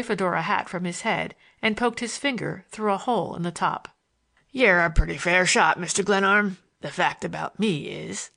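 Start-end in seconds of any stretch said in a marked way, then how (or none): 4.5-8.3 Morgan's voice. A narrator's reading. Morgan taunts the narrator in his Western voice, amused. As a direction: needle him with easy, sly mockery.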